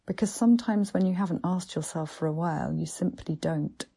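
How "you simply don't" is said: ordinary delivery, nothing marked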